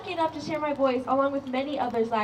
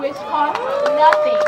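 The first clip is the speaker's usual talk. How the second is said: passionate rally cry; 11 semitones higher, 9 dB louder, slower than usual. Real, not acted. Rally speech climax.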